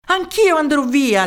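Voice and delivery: many voices answer in chorus, loudly. Acted. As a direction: call it out together. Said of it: "dissero in coro molte altre voci".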